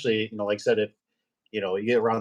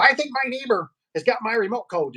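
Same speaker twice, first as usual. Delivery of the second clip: higher, whiny lady customer; mimicking the lady; 10 semitones higher than usual. Tech mimics the fussy lady customer, high and whiny. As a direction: play the complaining customer. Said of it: "She goes".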